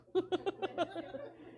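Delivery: a quick high burst of laughter. Real, not acted. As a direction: laugh it off, amused at yourself. Laughing at own past realisation.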